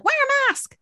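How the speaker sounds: high, mocking "wear a mask"; mock bossy order. Mocking people who tell her to wear a mask. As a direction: parody the people nagging her. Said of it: "when people are like".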